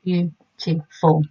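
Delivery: Beautiful drawn out slowly, each beat clear; slow syllable split. Shown as a demonstration. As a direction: tap out the three beats of Beautiful. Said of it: "I can hear the beautiful".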